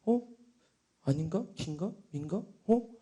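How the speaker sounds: small, soft, halting questions; conflicted doubt. Retold or imitated. Unsure believer asked about faith, hesitant muttering. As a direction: question yourself quietly, torn. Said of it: "갈등하면서".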